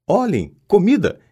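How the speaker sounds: high, eager call about the food; excited exclamation. A narrator's reading. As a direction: point out the food with delight. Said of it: "exclamou Rony". (exclamação animada)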